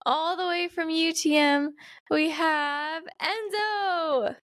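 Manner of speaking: drawn-out, rising-then-falling name reveal; announcer-style guest introduction. Real, not acted. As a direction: stretch it out to present the guest.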